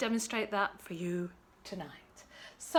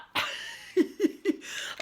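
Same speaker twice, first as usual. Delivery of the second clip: giggle; 11 semitones higher, 3 dB louder than usual. Real, not acted. Giggling about James Dean.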